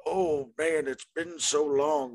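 put-on high creaky old-timer voice; cackling old geezer reminiscing; old prospector. Old-timer bit, high far voice.

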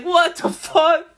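high, slow drawn-out disbelief; mock astonished cry. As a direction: stretch it out in total shock. Reacting to the backflip.

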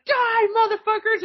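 high, loud gleeful-killer yell; mock battle cry. Daughter taking the gun to enemies, high mock battle cry.

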